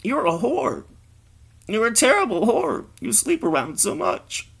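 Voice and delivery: high mock-female voice; scolding friend. Woman to her friend in a coffee shop, high scolding.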